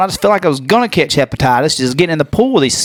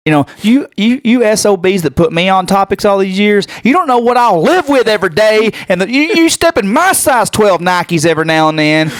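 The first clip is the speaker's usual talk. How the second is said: worked-up rant climbing in pitch; indignant rant; 6 semitones higher than usual. Real, not acted. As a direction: protest your innocence, pitch rising as you go. Indignant rant at his critics.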